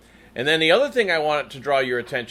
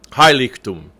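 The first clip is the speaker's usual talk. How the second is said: "Heiligtum" with a loud HEI, falling after; stress on first syllable; 2 semitones lower, 7 dB louder than usual. Shown as a demonstration. Coach showing the accent, "Heiligtum" stressed on its first syllable. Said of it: "the accent is on that first syllable".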